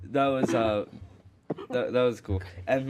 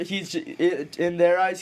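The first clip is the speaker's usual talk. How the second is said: ordinary talk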